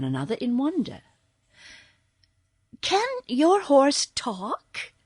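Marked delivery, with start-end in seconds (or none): 2.8-4.9 put-on wondering girl.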